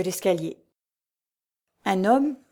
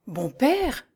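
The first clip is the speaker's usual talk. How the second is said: higher-pitched frightened exclamation; alarmed cry; 5 semitones higher than usual. A narrator's reading. Trembling old woman turns to the monk, alarmed cry. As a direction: cry out in fright, without raising the volume. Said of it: "s'écria-t-elle".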